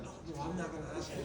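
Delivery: quick, soft, low mock aside; hushed refusal. Reluctant companion refusing to ask a stranger, hushed. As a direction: refuse under your breath, hurriedly, in a retold exchange.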